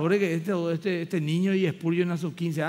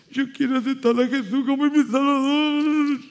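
put-on higher, louder little girl crying out; crying child; 6 semitones higher, 5 dB louder than usual. Girl at the altar telling the pastor, higher and louder through tears. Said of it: "Pasa ahí llorando".

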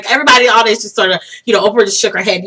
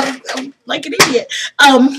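ordinary talk

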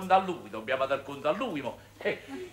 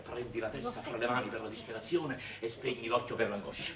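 muttered under the breath, softer; mumbled instruction; 6 semitones lower, 8 dB quieter than usual. Real, not acted. Father coaching the family, softly muttered. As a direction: mumble the direction so it can't be understood. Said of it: "ha già parlato francese".

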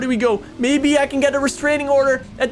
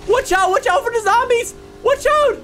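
high, panicked calling out; alarmed high cry; urgent warning; 9 semitones higher than usual. Pretend. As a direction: call a frantic warning that climbs higher. Warning her of zombies.

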